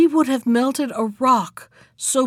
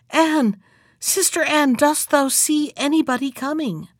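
ordinary delivery

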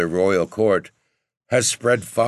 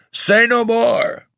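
much higher, loud, slow roar on NO; roaring king; 6 dB louder, slower than usual. Acted. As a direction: bellow it to cut him off. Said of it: "roared the king".

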